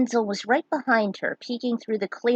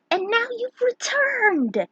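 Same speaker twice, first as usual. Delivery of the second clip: high, slow joyful cheer; cheering kids; 14 semitones higher, slower than usual. Acted. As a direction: cheer like happy children. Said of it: "the kids cheered".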